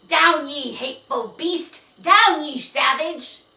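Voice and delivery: high, very loud furious yelling. Acted. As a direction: scream at the horse in rage. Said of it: "they heard Jiggy yell".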